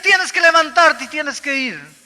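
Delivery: a high, loud, slow insistent voice; inner voice commanding. Retold or imitated. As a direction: let the voice command him, slow and strong. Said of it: "Había una voz que me decía". (voz interior insistente, fuerte y aguda)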